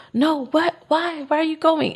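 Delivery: high pleading disbelief; imitating a friend's high startled protest; upset protest. Friend protesting her leaving.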